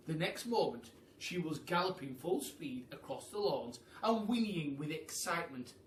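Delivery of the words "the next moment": ordinary delivery, nothing marked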